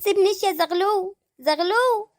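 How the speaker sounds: high pleading call after the husband; calling after him. Acted. Wife calling after Zaghloul as he leaves, high pleading call. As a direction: call him back as he walks out.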